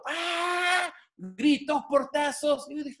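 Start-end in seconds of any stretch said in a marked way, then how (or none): 0.0-0.9 high, drawn-out imitated yell; imitated scream from next door; mock scream. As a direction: mimic a neighbour's sudden shriek, high and held. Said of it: "seis y veinte de la mañana empezó".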